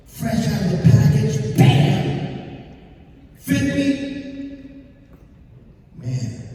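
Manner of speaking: normal talking voice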